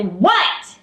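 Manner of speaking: annoyed "What" swooping up at the end; irritated teen. Retold or imitated. Teenager answering mother's question, irritated. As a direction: snap back a slightly irritated questioning "What". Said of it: "a little bit irritated with her".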